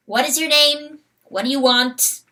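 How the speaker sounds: a hint of an impatient rooster asking; lightly voiced rooster. Rooster questioning the hawk, lightly voiced. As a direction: rooster demanding who the stranger is.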